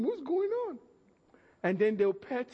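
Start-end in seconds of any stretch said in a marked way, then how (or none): 0.0-0.8 put-on puzzled boy. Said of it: "I was looking at him".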